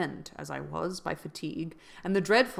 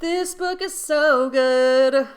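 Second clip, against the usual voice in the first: much higher, louder, slow gushing; excited gushing; 7 semitones higher, 11 dB louder, much slower than usual. Real, not acted. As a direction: rave about the book, drawing it out. Gushing about the book.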